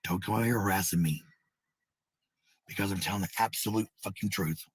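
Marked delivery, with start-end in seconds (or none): none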